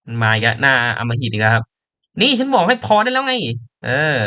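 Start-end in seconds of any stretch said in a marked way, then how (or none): 2.2-4.2 higher, sharper scolding; angry character. Acted. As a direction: tell them to shut up. (ตัวละครดุเสียงสูงให้หุบปาก)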